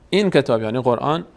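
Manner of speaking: ordinary delivery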